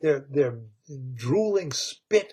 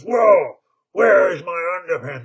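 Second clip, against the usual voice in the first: Krupp shocked to find himself naked, yelling; startled outcry; 2 semitones higher, 9 dB louder than usual. Acted. Mister Krupp, naked, yelling in shock for his underpants. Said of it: "And so he goes".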